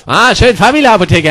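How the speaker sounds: usual speaking voice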